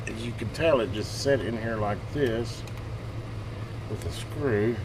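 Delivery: ordinary talk, nothing marked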